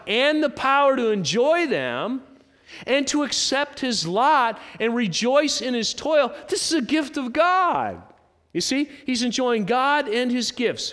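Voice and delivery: high, loud, ringing proclamation; impassioned scripture preaching. Acted. Preacher driving home God's gift to the congregation, impassioned. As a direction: proclaim the verse with full preacher's fervor.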